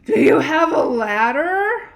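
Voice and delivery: growled. Acted. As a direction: bark the question up in frustration. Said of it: "He growled".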